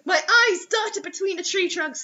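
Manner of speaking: ordinary delivery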